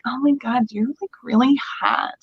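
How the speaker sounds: put-on light Valley Girl gush; Valley Girl accent, lightly put on; a hint of Valley Girl accent. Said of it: "the Valley Girl accent".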